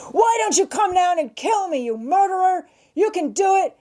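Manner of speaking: high, frantic daring yell; enraged defiant shouting. A narrator's reading. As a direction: shout a furious dare at the top of your lungs. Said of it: "reiterating at the top of his lungs".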